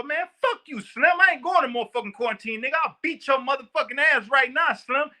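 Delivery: high, hammed-up furious cursing; mock angry outburst. Inmate cursing out the guard over quarantine, mock angry outburst.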